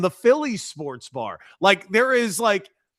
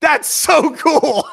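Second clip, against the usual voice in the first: laughing excited; 12 semitones higher, 10 dB louder, much slower than usual. Real, not acted. Thrilled at Howard Stern slot.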